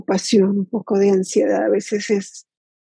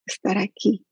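guided meditation. Acted. Teacher inviting the group to settle into body and present moment, guided meditation. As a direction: lead them gently into stillness, leaving long silences.

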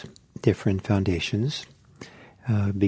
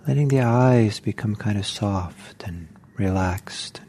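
guided meditation. Acted. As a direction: ease the group into stillness, one gentle cue at a time. Teacher leads a guided meditation.

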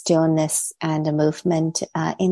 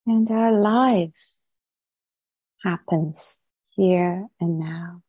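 guided meditation. Real, not acted. Teacher settling online meditators into posture and body, guided meditation. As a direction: ease them gently into stillness, leaving long pauses between phrases.

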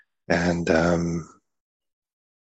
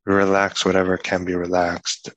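unhurried meditation guidance with long pauses; guided meditation. Acted. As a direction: ease them into relaxing the body, one part at a time.

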